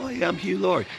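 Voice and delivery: a hint of an English gentleman's accent. The pianist retelling how the actor introduced himself, in a light English accent. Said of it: "talking with an English accent".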